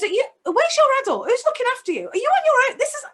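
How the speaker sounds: high, urgent, fussing questions; a kind man alarmed to find a child alone; worried stranger. Retold or imitated. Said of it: "and says".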